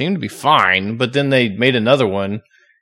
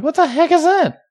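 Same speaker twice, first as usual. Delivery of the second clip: startled. Retold or imitated. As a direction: jump at a strange sound. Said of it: "And you're like".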